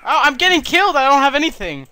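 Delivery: panicked. Real, not acted. Panicking while killed in game.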